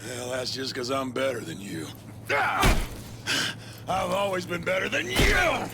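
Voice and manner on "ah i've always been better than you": higher, loud furious shout; rage outburst. Acted. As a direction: erupt in jealous fury. Villain explodes in rage.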